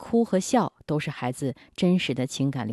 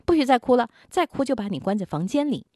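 impatient scolding mother; 7 semitones higher than usual. Retold or imitated. Mother scolding her crying son, impatient and sharp. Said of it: "而且责骂他".

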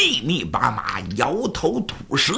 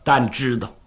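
ordinary delivery

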